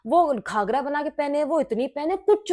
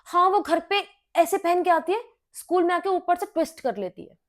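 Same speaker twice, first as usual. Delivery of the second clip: snide teacher; 6 semitones higher than usual. Retold or imitated. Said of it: "तो my teacher was like".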